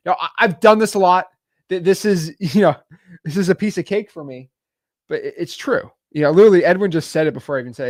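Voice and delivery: ordinary talk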